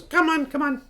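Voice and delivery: high-pitched eccentric hermit voice; high, odd little character voice; beckoning hermit. Acted. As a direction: squeal it eagerly to coax them along. Voices Prattis beckoning the players.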